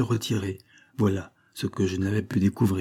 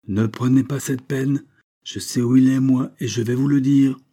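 louder, higher, with a frightening smile; menacing restrained anger; 5 semitones higher, 5 dB louder than usual. A narrator's reading. Doctor stops the father from leaving, menacing. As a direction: cut in with cold, forceful menace. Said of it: "un sourire plus effrayant que la plus terrible colère".

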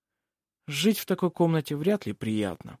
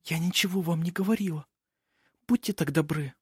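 put-on high, soft girlish plea; timid pleading girl; 5 semitones higher, 4 dB quieter than usual. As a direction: beg gently for secrecy. Nikola pleads for silence.

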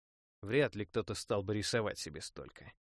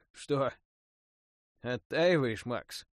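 amused teasing smirk; 3 semitones higher, 7 dB louder, much slower than usual. Acted. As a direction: tease him with a smirk, louder. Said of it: "усмехнулся он".

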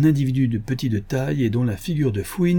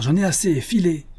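higher, exasperated send-off; acted irritated outburst; angry dismissal; 8 semitones higher than usual. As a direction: order them away, fed up. Dealer ends the acted quarrel.

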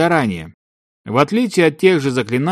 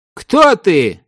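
sharp loud demand; shout; 7 semitones higher, 5 dB louder than usual. A narrator's reading. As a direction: call out the challenge loudly. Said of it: "выкрикнул один из заклинателей".

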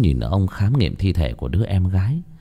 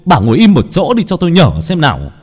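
father snapping at his pacing wife, rising higher and louder; father snaps at mother to stop pacing, building to a higher, louder scold; impatient scolding; 4 semitones higher, 6 dB louder than usual. A narrator's reading. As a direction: scold her to sit still, building to a shout. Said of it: "Cha tôi sốt ruột quát mẹ".

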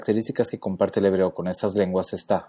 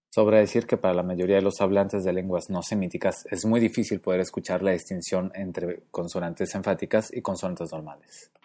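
ordinary talk

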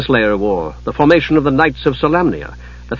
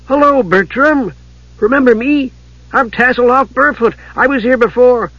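eager kender; 11 semitones higher than usual. A narrator's reading. Tasselhoff reminding the monk they have met, high-pitched kender. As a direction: pipe it up high, greeting an acquaintance.

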